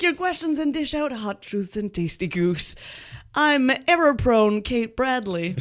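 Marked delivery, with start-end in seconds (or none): none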